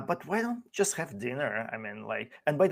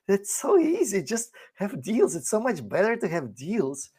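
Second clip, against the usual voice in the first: higher, smug, and falsely easygoing; imitating the officials' smug smile, higher and mocking; smug mock cheer; 6 semitones higher than usual. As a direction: sell it like it's the easiest thing. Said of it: "they have this J D Vance smile".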